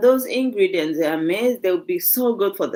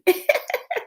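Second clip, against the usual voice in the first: laughing; 3 dB quieter than usual. Real, not acted. Laughing at the viewer's comment.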